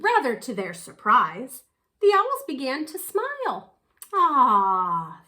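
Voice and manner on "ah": breathy ah. Acted. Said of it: "they breathed".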